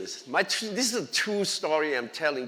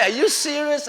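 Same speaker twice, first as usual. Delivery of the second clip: amazed laughing question; 10 semitones higher, 8 dB louder than usual. Retold or imitated. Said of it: "He said".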